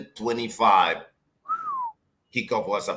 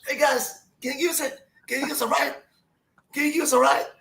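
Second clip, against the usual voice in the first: flag them down, hailing across the street; mom calling out; 12 semitones higher than usual. Retold or imitated. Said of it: "You know what my mama did?".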